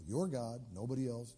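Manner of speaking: usual speaking voice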